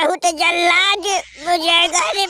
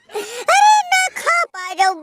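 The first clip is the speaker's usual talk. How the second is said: much higher, crying shout; hammed-up wailing cry; 9 semitones higher than usual. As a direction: break into an overdone sob-yell. Comic lament, cry and shout heard. (ऊँची आवाज़ में नाटकीय रोते हुए चीख)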